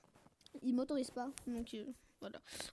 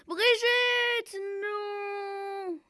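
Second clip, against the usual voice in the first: long high cry of the name, fading; hammed-up grief, drawn-out wail; mock-tragic wail; 6 semitones higher, much slower than usual. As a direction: mourn a teammate melodramatically. Mourning teammate melodramatically.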